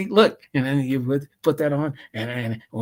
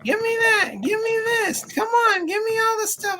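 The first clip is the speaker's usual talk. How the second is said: whiny demanding fan. Retold or imitated. Brent mocked demanding an autograph from David Yost, high shouty whine. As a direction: squeal it like a greedy fan begging. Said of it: "So Brent goes".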